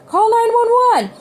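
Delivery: high, loud panicked shout; frantic father screaming an order. A narrator's reading. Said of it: "the man shouted at her".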